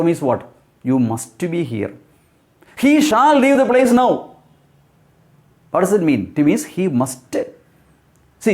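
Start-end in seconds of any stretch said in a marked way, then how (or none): 2.8-4.3 high, commanding "He shall leave the place now"; emphatic. Shown as a demonstration. Teacher gives learners an example of must, emphatic. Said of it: "Something very strongly pronounced or announced".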